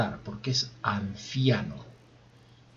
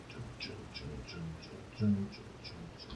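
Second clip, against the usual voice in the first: whispered chun chun noise; 4 semitones lower, 8 dB quieter than usual. Pretend. Imitated noise, mostly unvoiced.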